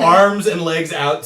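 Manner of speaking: ordinary delivery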